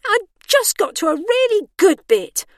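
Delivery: high, loud starlet whine; aggrieved complaint. A narrator's reading. Aggrieved starlet wants dream back.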